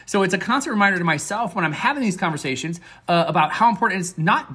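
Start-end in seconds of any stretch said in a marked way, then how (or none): none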